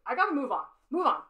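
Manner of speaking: loud, firm push to herself to move on; loud self-push. Real, not acted. Artist at her drawing urging herself on, loud and firm.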